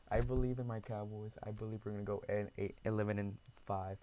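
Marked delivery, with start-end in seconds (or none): none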